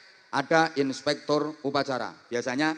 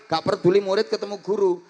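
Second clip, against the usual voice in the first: usual speaking voice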